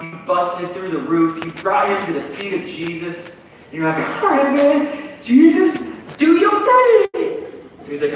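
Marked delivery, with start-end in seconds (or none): 4.2-7.4 high, loud, slow egging on; eager friend. Retold or imitated. Friend urging Jesus to heal, high and excited. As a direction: hype Jesus up to act.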